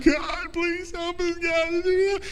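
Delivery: high, pleading cry of Moses; high desperate plea for help; desperate cry to God. Acted. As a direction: plead upward like a frightened man at the sea's edge. Moses crying out at Red Sea.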